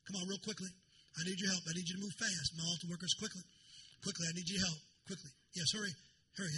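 normal talking voice

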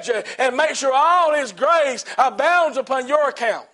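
high, driving, rhythmic exhortation; full-force preaching. Real, not acted. Preacher urging the church to keep calling out, full-force preaching. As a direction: build into high-pitched chanting sermon cadence.

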